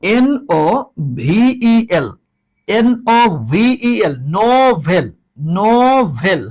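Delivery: N-O-V-E-L spelled letter by letter, then "নোভেল" said with a clear v sound. Shown as a demonstration. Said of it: "আরেকটা হচ্ছে দেখো". (বানান করে "নোভেল" ভ দিয়ে বলো)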